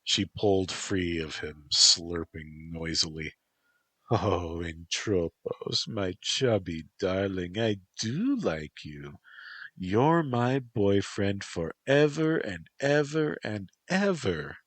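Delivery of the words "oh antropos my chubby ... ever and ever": high, sing-song doting voice; Toko, cooing. Acted. Toko cooing to her chubby boyfriend, high doting voice. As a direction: coo it like a doting girlfriend.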